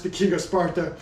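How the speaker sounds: usual speaking voice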